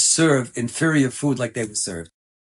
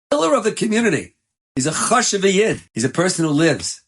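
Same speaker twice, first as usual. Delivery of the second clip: rising fervor; 8 semitones higher, 4 dB louder than usual. Acted. Preacher's climax.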